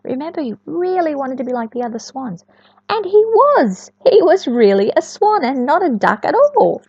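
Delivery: ordinary delivery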